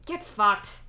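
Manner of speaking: loud, through a mouthful; mouth full. Acted. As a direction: snap it with food in your mouth. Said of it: "says loudly through a mouthful of sub".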